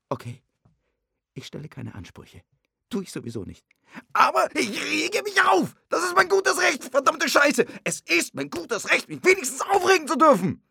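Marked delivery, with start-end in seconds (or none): 4.1-10.6 luid en hoger geschreeuwd; woedende uitbarsting. Acted. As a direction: schreeuw je liefde kwaad uit. Ruzie, hij schreeuwt.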